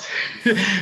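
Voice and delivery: a burst of high, loud laughter. Real, not acted. Laughing at his opponent's look.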